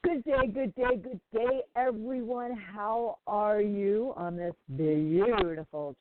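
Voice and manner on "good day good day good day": ordinary delivery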